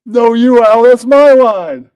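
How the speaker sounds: high loud protest; shouting. Real, not acted. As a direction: yell it, slowly. Shouting line correction.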